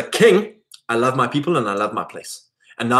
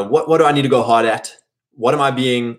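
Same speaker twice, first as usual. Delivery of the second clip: ordinary delivery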